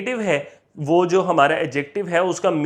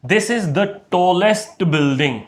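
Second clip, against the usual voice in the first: very slow example sentence; slow teacher dictation; much slower than usual. Shown as a demonstration. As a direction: read it out word by word for the class. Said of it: "For example".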